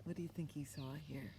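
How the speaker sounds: ordinary delivery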